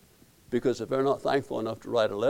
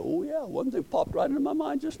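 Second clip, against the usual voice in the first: mock eureka; 14 semitones higher than usual. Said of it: "I said".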